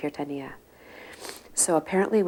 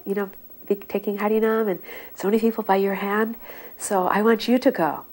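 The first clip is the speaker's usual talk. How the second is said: voiced guru urging; 6 semitones higher, 5 dB louder than usual. Guru urging the active preacher to go West, higher and louder. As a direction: press him to go like a fond guru.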